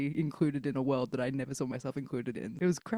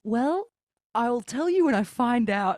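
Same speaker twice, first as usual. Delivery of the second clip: higher, louder reply after laughing; amused comeback; 6 semitones higher, 4 dB louder, slower than usual. Real, not acted. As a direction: answer through the tail of a laugh. Laughing reply after awkward question.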